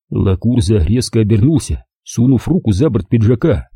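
ordinary delivery, nothing marked

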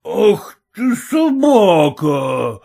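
put-on loud, slow drunken wail; drunken cry. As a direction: howl it like a drunk father. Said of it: "твердил отец и, шатаясь".

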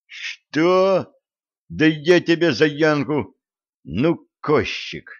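high, indignant angry retort; angry outburst. A narrator's reading. Vitalik flares up in anger at the raven's threat. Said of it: "разозлился Виталий".

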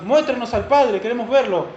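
loud, high plea of a disciple; Tomás pidiendo. Acted. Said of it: "Le dijo Tomás". (ruego fuerte y agudo, actuado)

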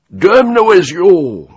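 very high, drawn-out bellow; furious yell. A narrator's reading. Major curses his subordinate, yelling. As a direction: yell the curse at full force. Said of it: "wrzasnął".

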